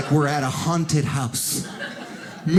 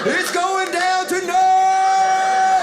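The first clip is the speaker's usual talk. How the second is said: MMA hype man; 12 semitones higher, slower than usual. Retold or imitated. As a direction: hype it up like ringside. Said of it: "They turned into M M A hype men".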